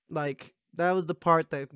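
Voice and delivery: ordinary delivery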